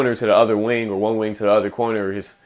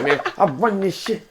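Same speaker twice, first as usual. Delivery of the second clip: higher, laughing mock brag; mock cocky boast; 9 semitones higher than usual. Podcast cohost mocking a cocky player, high laughing brag. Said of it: "You're like".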